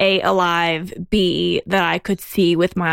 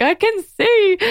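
tearful cry; 12 semitones higher, 3 dB louder, slower than usual. Retold or imitated. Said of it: "I was like".

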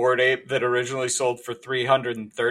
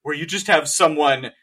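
usual speaking voice

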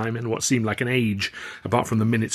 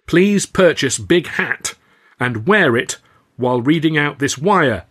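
telegram read; 5 semitones higher, 7 dB louder, slower than usual. A narrator's reading. Reading a telegram aloud.

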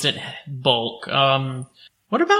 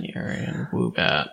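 disappointed muttering to herself; whispered mutter; 12 semitones lower, 6 dB quieter than usual. Real, not acted. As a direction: whisper the names under your breath. Repeats missed answers to herself.

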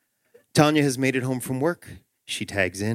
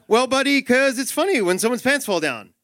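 exasperated dad; 11 semitones higher than usual. Acted. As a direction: explain it with the voice climbing. Dad straining to answer child.

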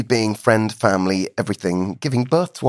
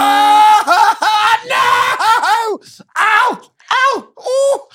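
pained cries; 5 dB louder, much slower than usual. Real, not acted. Crying out at top level.

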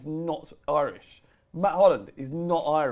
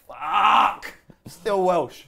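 loud, higher mock cry of despair; mock anguished outburst; 4 semitones higher, 8 dB louder, much slower than usual. Mocking Bale to his co-host, a loud, higher despairing cry. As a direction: bellow it like waking to bad news. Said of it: "wakes up every morning going".

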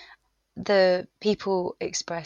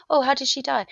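mock-chirpy florist asking, higher and louder; imitating blunt florist; 7 semitones higher, 6 dB louder, faster than usual. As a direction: toss off the nosy question lightly. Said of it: "And she went".

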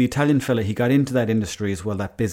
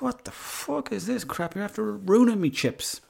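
high-pitched mock outrage; incredulous complaint; 10 semitones higher, 4 dB quieter than usual. Young customer tasting vinegary chips, mock-appalled. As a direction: complain in a high, held-back, aghast voice.

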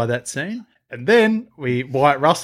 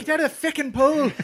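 high mock Irish, feckin' slurred; Irish accent; 13 semitones higher than usual. Co-host joins Irish pool scolding. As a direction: ham the Irish curse. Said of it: "an Irish accent".

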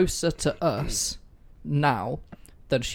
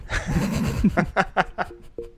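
breathy laughter; 5 semitones higher than usual. Real, not acted. Cat screamed at host.

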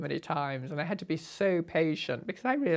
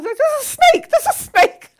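imitating a panicked shriek, much higher and louder; mock shriek; 14 dB louder than usual. Retelling Mary spotting the snake, mock panicked shriek. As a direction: shriek it like the panicked hostess, half laughing. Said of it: "she shrieked her head off".

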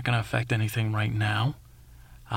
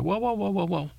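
alarmed whoa; 8 semitones higher than usual. Retold or imitated. Rushing to help fallen girl.

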